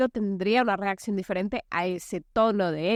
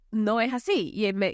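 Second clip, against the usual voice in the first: normal talking voice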